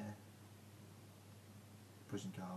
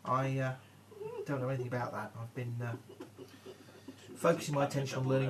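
flustered denial; 4 semitones higher, 8 dB louder than usual. Acted. Prisoner denies, flustered.

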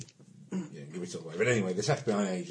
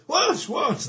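high sharp startled questions; mock alarm, much higher; retold alarmed what; 9 dB louder than usual. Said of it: "they go".

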